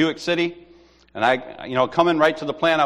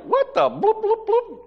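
high, laughing mock outrage with bleeped swears; laughing cuss-out; slower than usual. Another brother reacting to the moon-mining claim, laughing. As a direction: sputter a censored cuss through laughter. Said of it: "But he was like".

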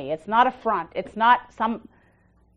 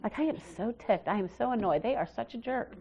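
hushed, much softer gossip voice; 10 dB quieter than usual. Retold or imitated. A gossiper complaining to someone else about a person, softly and hushed. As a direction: lean in and dish the dirt softly. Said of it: "And they'll go".

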